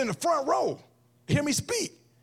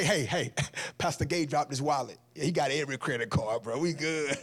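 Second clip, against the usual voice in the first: lower, softer, sneaky pal keeping the wallet; sly under-the-breath buddy; sly friend; 10 semitones lower, 4 dB quieter than usual. Pretend. Dishonest friend pocketing wallet.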